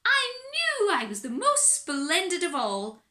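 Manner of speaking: high, loud gloating declaration; triumphant cry. Acted. Little Miss Splendid crows over the mirror's answer. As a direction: crow it out in smug triumph. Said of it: "cried Little Miss Splendid".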